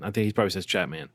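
ordinary delivery

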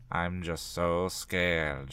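scared, subdued. A narrator's reading. Dina finally admits fear after silence, subdued and slow. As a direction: draw it out quietly.

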